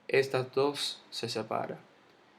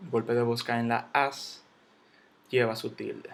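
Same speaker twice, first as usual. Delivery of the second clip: normal talking voice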